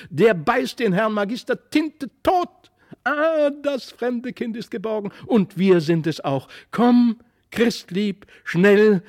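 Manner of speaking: put-on high, jubilant boy's cry; excited shouting. A narrator's reading. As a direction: build to loud, urgent calls. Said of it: "schrie Felix".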